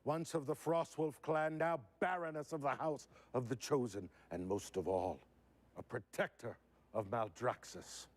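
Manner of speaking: Draka voice. Acted. Voice actor reading Draka's lines for the panel, heroic. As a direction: declare allegiance, then cry out for the fallen. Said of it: "Okay, [name]".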